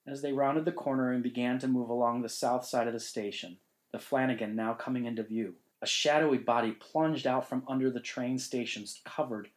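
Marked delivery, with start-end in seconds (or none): none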